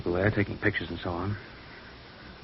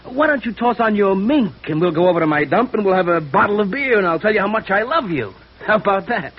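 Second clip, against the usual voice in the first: much higher and louder, a mock grand invitation; hammed-up daydream pitch; 12 semitones higher, 5 dB louder than usual. Young man acting out asking Betsy to go out, much higher and louder. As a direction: play the imagined suitor big and high. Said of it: "Then I'd say".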